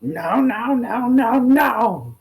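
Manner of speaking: high grating whiny voice; grating character voice. Acted. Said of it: "it's grating, but it is a voice".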